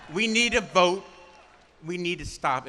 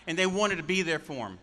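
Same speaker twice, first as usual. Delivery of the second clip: ordinary talk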